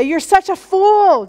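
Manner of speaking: high, drawn-out scornful rebuke; acted scorn at a wrongdoer; mock scolding. Imagined scolding voice of God.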